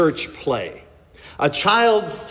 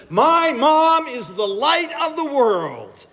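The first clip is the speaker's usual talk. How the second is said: put-on confident child; 12 semitones higher, slower than usual. Child on stage proudly getting the line wrong, high and confident. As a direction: blurt it out like a proud little kid. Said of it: "with complete confidence and a strong voice".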